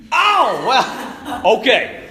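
high laughing oh well okay; a burst of amused disbelief; laughing mock surprise. Reacting to being told more gear.

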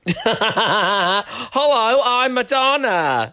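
high, slow campy drag queen voice; failing drag queen. Retold or imitated. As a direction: ham up a bad [name] impression. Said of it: "great impression of a failing drag queen".

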